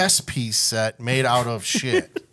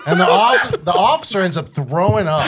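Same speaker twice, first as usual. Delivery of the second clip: laughing through the punchline, louder; 6 semitones higher, 7 dB louder than usual. Real, not acted. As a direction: push the words out through real laughter. Laughs while telling punchline.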